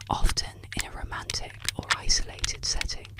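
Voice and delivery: plain reading voice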